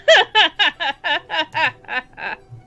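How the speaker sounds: long rattling laugh, sliding downward; villainess laugh. Acted. Alien guest laughing at her puzzled servant, gleeful cackle. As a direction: keep cackling in a falling staccato. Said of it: "She pauses her laughter".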